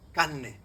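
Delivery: "carne" as "canne", r turned into long n; Andalusian assimilation. Shown as a demonstration. Andalusian lecturer showing their own speech, doubled n. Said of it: "Nosotros decimos carne".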